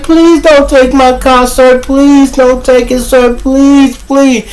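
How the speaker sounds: high, drawn-out imitation of a woman begging; hollered pleading. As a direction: holler slowly for mercy, voice way up high. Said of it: "She hollering".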